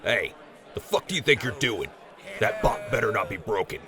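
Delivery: loud angry shout; angry yell. Acted. Raider yelling at partner for hitting the robot, loud and angry. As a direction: yell him off the bot.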